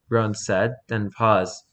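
plain reading voice

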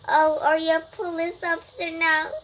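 high, loud sneering question; mocking tease. A narrator's reading. Girls mocking Tina at the park, loud sneering tease. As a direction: taunt her with a sing-song jeer. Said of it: "teased the girls".

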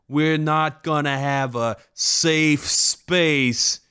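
high, slow sneering comedian; hammy stand-up delivery; hack comic voice. Retold or imitated. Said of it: "It's like".

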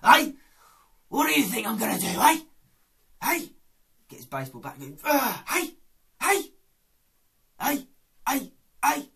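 loud, high barking hey; menacing East End hard man; Phil Mitchell impression. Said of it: "Phil Mitchell".

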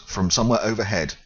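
ordinary delivery

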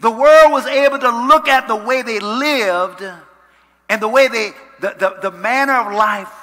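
very loud, high-pitched sermon surge; full-force preaching. Real, not acted. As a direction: drive the point home at full voice.

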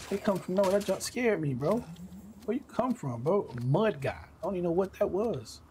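jumpy after a sudden scare; scared horror game streamer. Real, not acted. Startled by mud monster attack.

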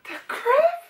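high squeal of disbelief; shocked. Real, not acted. Stunned at a three-hour read.

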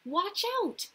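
high, bright mock alarm; playful warning. Storyteller warns the children of a leaping grasshopper, playful. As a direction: call out like a bug leaps past.

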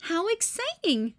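high, delighted grandma voice; light up with cheerful delight. A narrator's reading. Grandma greets the uninvited guests delightedly. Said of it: "said Grandma".

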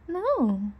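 high, loud mock-answer; scolding no. Scolding the pup for explaining, high and emphatic.

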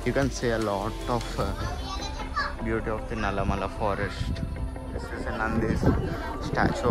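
softly spoken temple tour; hushed commentary. Real, not acted. Hushed commentary while visiting Shiva temple.

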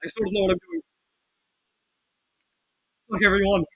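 usual speaking voice

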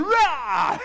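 very high, loud mock roar; wild pouncing roar. Mary turning around to grab Jesus, high hammed-up roar. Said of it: "Mary turning around and going".

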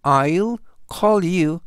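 very slow, careful English reading; slow model sentence. Shown as a demonstration. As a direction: read it out word by word for learners. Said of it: "활용 문장 보시겠습니다". (아주 천천히 또박또박 읽는 예문)

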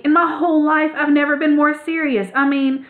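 mean every word, building up; earnestly. A narrator's reading. Ragweed earnestly pitching the new club to Clutch. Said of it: "Ragweed said earnestly".

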